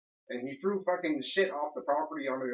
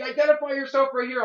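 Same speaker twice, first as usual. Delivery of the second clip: loud, higher bossy command; mock guard order; 10 semitones higher, 9 dB louder than usual. Tenant imitating the guard insisting on ID, loud mock command. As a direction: play the guard ordering him. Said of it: "He's like".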